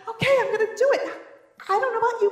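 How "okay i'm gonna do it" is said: fast, high, laughing go-for-it; nervous laugh before jumping in; laughing bravado. Retold or imitated. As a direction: psych yourself up with a laugh. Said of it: "you're like".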